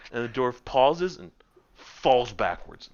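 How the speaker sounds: normal talking voice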